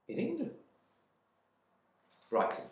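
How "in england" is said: high, disbelieving question; incredulous wife. Acted. Wife disbelieving her husband's answer, high pitched. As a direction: she can't believe her ears.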